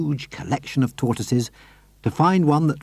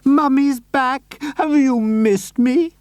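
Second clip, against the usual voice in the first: much higher, slow, doting lady's voice; Missus Silver crying out; 14 semitones higher, 4 dB louder, slower than usual. A narrator's reading. Missus Silver greeting her tortoise, high and doting. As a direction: coo over the tortoise lovingly. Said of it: "she cried out".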